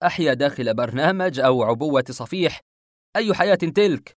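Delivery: loud, scornful questions; sarcastic. A narrator's reading. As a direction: scoff at the offer, rising to an indignant peak. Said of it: "قلت بسخرية". (بسخرية وصوت عالٍ)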